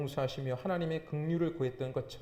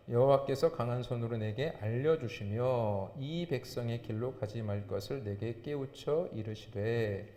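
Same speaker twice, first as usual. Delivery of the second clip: slow, low, measured recitation; scripture reading; 6 semitones lower, slower than usual. Real, not acted. Preacher reading verses aloud with the congregation, solemn recitation. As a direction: read the verses aloud evenly, low-pitched.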